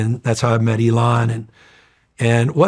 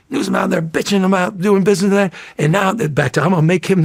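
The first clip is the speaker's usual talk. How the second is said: Willie Brown impression; 7 semitones higher than usual. Retelling how the mayor talked about him, in his imitated voice. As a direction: lightly mimic a wily veteran mayor. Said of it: "And he talks like this".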